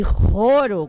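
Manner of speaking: RORO with a strong rasping throat H; rough, forceful throat sound opening RORO; guttural H. Shown as a demonstration. Teacher contrasts the accented letter, guttural RORO. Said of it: "com acento".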